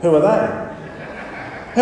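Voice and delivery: whispered through a real laugh; breathy laugh. Real, not acted. Pastor laughing at youth group who missed the Beatles, whispery laughing. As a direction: laugh it out, hardly voiced, very slow. Laughing at the kids' blank reply.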